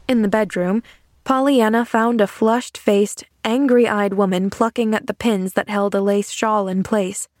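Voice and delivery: plain reading voice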